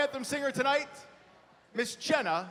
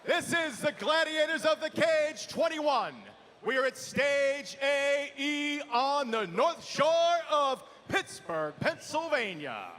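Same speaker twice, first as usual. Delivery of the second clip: arena announcer; 3 semitones higher than usual. Real, not acted. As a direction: fire up the crowd from the cage. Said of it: "to our ring announcer".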